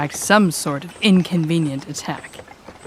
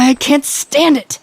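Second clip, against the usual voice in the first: exasperated outburst; 7 semitones higher, 4 dB louder than usual. Acted. Breaks the silence in frustration.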